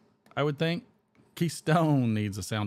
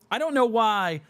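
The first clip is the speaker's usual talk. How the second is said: put-on whine; 10 semitones higher, 6 dB louder than usual.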